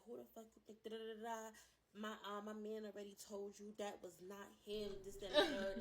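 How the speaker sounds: retold yelling. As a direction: yell it down the phone at the girl. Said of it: "I'm yelling, I'm screaming".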